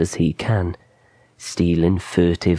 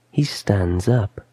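usual speaking voice

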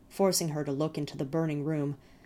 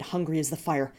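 ordinary delivery

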